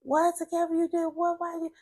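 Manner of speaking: high-pitched mock disbelief; shocked disbelief. People learning she stole from mother, imitated high shock. Retold shocked reaction to her theft.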